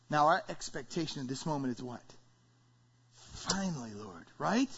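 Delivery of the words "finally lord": low, hushed, dropping away; relieved sigh. Acted. Believer answers God's promise, hushed relief. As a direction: let it out under the breath. Acted relieved response to God.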